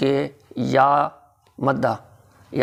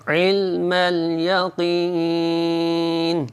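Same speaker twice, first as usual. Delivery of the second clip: slow melodic tajweed recitation; slow melodic Quran recitation; 4 semitones higher, much slower than usual. Shown as a demonstration. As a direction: chant it slowly, each syllable drawn out. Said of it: "Urdu تلفظ دیکھیے".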